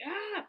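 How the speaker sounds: short high guttural shout; guttural cry. Shown as a demonstration. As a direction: let out a guttural ah. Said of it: "in guttural sounds".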